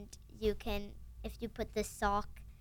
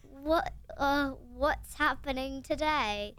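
slow, higher, hammed-up British; terrible British accent; 4 semitones higher, 3 dB louder, much slower than usual. Shown as a demonstration. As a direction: put on a bad British accent, drawn out. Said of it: "which is a terrible British accent".

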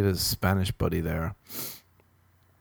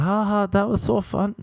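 put-on Spanish accent slipping towards Chinese; high, badly faked Spanish accent; botched Spanish accent; 11 semitones higher than usual. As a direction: attempt a Spanish accent and miss. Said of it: "No, that's gonna be Chinese".

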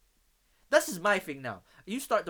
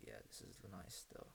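whisper; 10 semitones lower, 19 dB quieter than usual. Retold or imitated. Tasting friend's rum punch.

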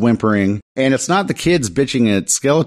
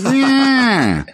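a much higher, dismissive drawn-out yeah; mock Skeletor yeah. Skeletor brushing off the dying kids, mock dismissive. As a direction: toss it off like an unbothered villain.

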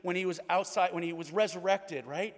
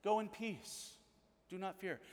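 tender reassurance; 2 semitones higher, 8 dB quieter than usual. Retold or imitated. Jesus reassures the ruler gently.

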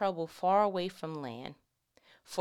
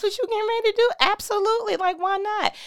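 mock incredulous listener; 10 dB louder, faster than usual. Imagined listener, high and excited.

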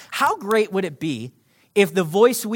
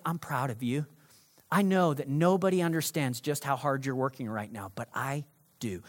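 gentle God voice; 5 semitones lower, 6 dB quieter than usual. Acted. Preacher voicing God softly.

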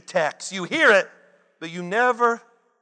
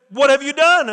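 raised, louder, higher demand; stern demand; 5 semitones higher, 5 dB louder than usual. Acted. God demands an answer from the woman, raised and stern. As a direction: confront her hard: what have you done.

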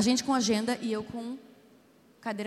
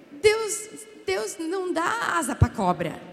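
higher, slow, laughing proverb; laughing punchline; 6 semitones higher, 3 dB louder, much slower than usual. Real, not acted. Preacher laughing as she delivers the proverb. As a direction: land it with a laugh.

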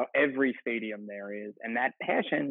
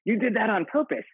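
higher, louder, delighted imitation; imitating cheerful player; 9 semitones higher, 5 dB louder than usual. Firefighter voices Danny challenging Jamie, higher and louder. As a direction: play it like an eager kid joining a game. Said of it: "And he's like".

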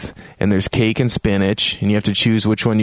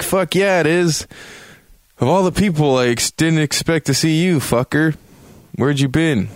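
raised-pitch mock voice for a brash brother; retold brash reunion; 6 semitones higher than usual. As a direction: act out a cocky reunion, pitched up. Acts brother's surprise reunion in retelling.

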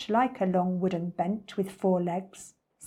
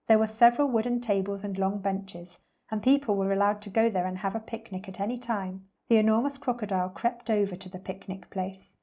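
ordinary delivery

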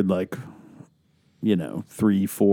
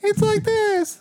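put-on very high falsetto, copying a girl voice; little girl voice; slower than usual. Friend mimicking dad's girl voice, little girl falsetto. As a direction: echo it in a squeaky girl voice. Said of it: "girl voice sound like".

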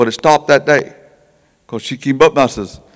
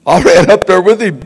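laughing; 5 semitones higher, 8 dB louder than usual. Real, not acted. Laughs recalling running up.

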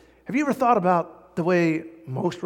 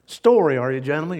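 ordinary delivery

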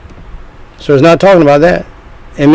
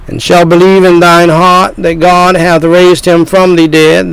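usual speaking voice

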